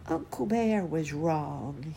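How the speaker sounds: slow, drawn-out cub voice; earnest bear cub. A narrator's reading. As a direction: declare it slowly as a little cub. Cub addresses grown-up bears.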